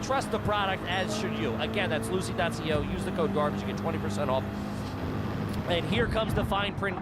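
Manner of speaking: upbeat sponsor read. Acted. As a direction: read it like a podcast ad. Podcast host ad read.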